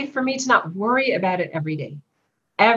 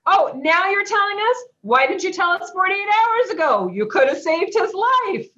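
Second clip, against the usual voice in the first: higher, mock-indignant comeback; sarcastic retort; 9 semitones higher than usual. A grieving mother mocks the visitor with a higher, sarcastic retort. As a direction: throw it back at them with sarcasm, pitched up.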